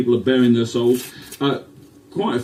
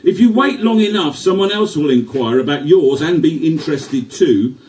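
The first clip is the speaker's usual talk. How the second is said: poem recital; 4 semitones higher than usual. Acted.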